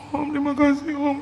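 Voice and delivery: high mock-sobbing squeak; imitated crying thanks. Pastor keeps thanking the donor, imitated crying. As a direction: whimper thanks in a put-on cry. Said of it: "Kenapa dia nangis ya?".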